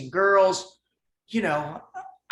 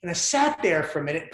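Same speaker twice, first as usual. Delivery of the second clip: normal talking voice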